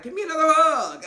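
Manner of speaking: loud, high, whooping imitation; mock eager demand. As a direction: call out for a hug, over the top. Imitating his eager hug demand.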